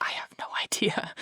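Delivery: retold whisper, as in church; whispered aside. Daughter answering mom during the preaching, whispered. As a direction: whisper it back discreetly.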